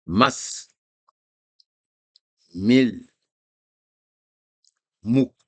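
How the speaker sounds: "mas", "mil", "mouk" each one short closed syllable; example readings. Shown as a demonstration. Teacher listing examples for students, clipped. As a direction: clip each word off on its last consonant. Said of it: "Par exemple".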